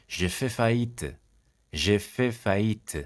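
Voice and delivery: each syllable drawn out slowly for learners; slow model phrase. Shown as a demonstration. French teacher to students, slow model read. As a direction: say it slowly and clearly.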